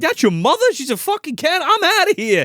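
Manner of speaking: high, loud alarmed outburst; hammed-up freaked-out friend; mock panic. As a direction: blurt it and bolt. Acting panicked friend fleeing.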